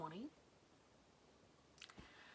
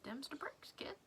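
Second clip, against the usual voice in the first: a quick, light Brooklyn cartoon-cat tag line; a hint of a bad Brooklyn accent; Meowth Brooklyn accent; 8 semitones higher than usual. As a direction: sign off like Meowth in mock Brooklyn. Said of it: "I don't think I do a very good Meowth impression".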